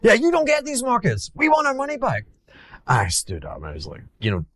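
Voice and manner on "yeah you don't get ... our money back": very high mock Italian accent. Italian clients demanding their money back, high-pitched Italian accent. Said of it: "I'm putting on an Italian accent".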